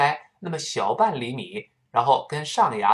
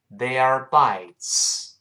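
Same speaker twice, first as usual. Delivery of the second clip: 慢读；很慢地逐词读They are bites；三个词拉长、分开读；慢速领读示范; much slower than usual. Shown as a demonstration. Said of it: "跟老师先慢慢读一下".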